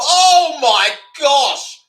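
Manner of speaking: loud, drawn-out shocked cry; stunned exclamation. Real, not acted. Streamer seeing a sudden knockout, shocked loud cry. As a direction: really stretch the gasp of disbelief.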